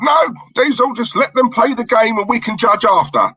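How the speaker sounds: high-pitched imitation of a co-host objecting; Terry impression. Host imagining Terry objecting to judging the lineup, high mock protest. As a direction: mimic a protesting colleague, pitch lifted well up. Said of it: "he'd be like".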